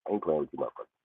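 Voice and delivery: ordinary talk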